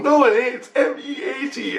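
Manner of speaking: high-pitched mock protest; imitated arguing daughter. Daughter corrects dad about meat, imitated in high protest.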